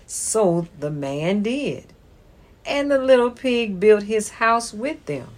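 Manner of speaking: ordinary delivery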